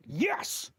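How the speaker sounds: a quick, high cheer of delight; excited cheer. Real, not acted. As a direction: celebrate the good score with a yelp. Cheers at the accent rating.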